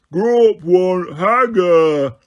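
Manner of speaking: slow, loud simple giant demand. A narrator's reading. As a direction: say it slowly like a dim giant.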